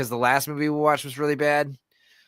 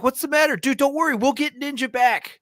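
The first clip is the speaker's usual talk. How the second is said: high, hammed-up mock reassurance; consoling buddy; 12 semitones higher than usual. Comedian quoting a movie sidekick, high hammed-up comfort. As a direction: squeak it out like a goofy movie sidekick. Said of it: "He's like".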